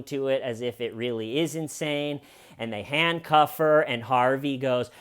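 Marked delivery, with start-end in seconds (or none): none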